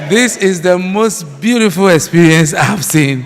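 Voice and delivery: carried away by delight at the event; real excitement. Real, not acted. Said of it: "am very excited, very happy".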